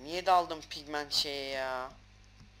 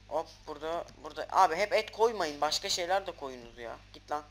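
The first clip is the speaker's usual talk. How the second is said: ordinary talk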